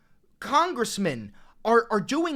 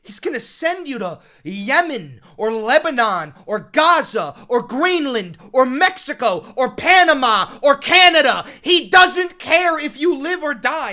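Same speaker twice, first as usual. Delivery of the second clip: loud, urgent warning rising to a yell; too loud; 2 semitones higher, 10 dB louder than usual. Real, not acted. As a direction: rant at full force, hammering each place in the list. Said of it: "my audio's getting too loud".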